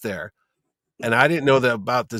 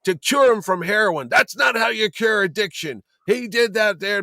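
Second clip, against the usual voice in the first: higher, slow, indignant rant; old man's rant; 7 semitones higher, slower than usual. Retold or imitated. Old writer complaining about his ruined story, indignant rant. Denny rants about the page.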